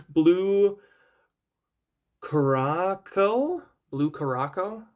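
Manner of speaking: "Blue Caraco" sounded out haltingly, rising into a question; unsure pronunciation. Real, not acted. Non-drinker guessing at a drink's name, "Blue Caraco" said as a hesitant question. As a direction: try "Blue Caraco" slowly, then repeat it, still questioning. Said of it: "I don't know how to pronounce these things".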